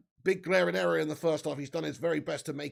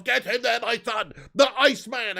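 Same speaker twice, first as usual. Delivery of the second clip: loud, raised-pitch fan celebration; rousing cheer; 5 semitones higher, 8 dB louder than usual. Real, not acted. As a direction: cheer your player on with force. Cheering a defender's tackle.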